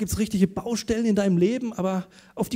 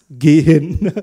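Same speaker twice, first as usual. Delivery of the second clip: louder, bark it out as an order; loud firm command; 3 semitones lower, 12 dB louder than usual. Retold or imitated. Pastor orders scared friend to the dentist: loud firm command. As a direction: insist he goes, no arguing. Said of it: "Und ich zu ihm gesagt".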